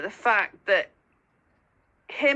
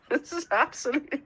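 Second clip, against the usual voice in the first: normal talking voice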